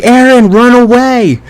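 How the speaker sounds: high-pitched mock cry of warning; imitated scream. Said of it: "Armin's screaming at him".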